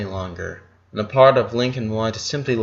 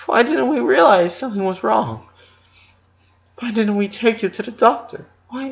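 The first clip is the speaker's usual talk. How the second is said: high, pained voice of a grieving mother; mother blaming herself to her son, near tears; crying mother; 13 semitones higher than usual. A narrator's reading. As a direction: let the guilt spill out in rising anguish. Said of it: "make her stop crying".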